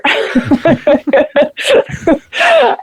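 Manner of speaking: high bursting laugh at her own joke; real laugh. Real, not acted. Laughing after calling the photographer a creeper.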